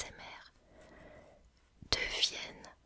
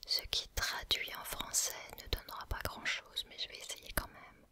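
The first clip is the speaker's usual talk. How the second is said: usual speaking voice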